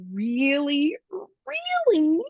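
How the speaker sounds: REALLY said twice, slow, rising sharply in pitch; drawn-out emphasis. Retold or imitated. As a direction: draw out each REALLY with a pause between, climbing. Emphatic repeated word while retelling realisation.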